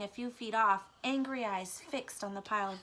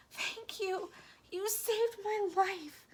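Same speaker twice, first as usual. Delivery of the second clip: high, thin, slow mouse voice; a frail little mouse voice, pitched high; 12 semitones higher, 3 dB louder than usual. A narrator's reading. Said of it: "he asked".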